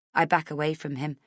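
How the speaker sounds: ordinary delivery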